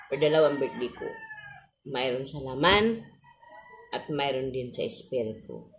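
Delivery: ordinary talk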